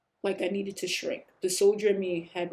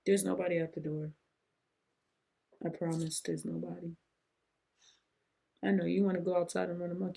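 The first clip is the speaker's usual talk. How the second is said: quiet aside; 5 dB quieter than usual. Real, not acted. Aside to someone wanting outside.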